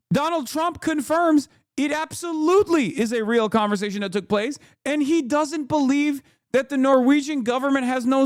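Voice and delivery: high-pitched, real incredulous outburst; exasperated disbelief. Real, not acted. Streamer reacting to Trump confirming the story, exasperated disbelief. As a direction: let disbelief push the voice right up.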